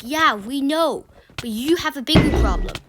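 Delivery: scolding teacher. Retold or imitated. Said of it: "And then she's like".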